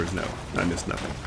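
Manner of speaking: breathy secret whisper. Real, not acted. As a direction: hush it like a secret. Whispered aside after game check.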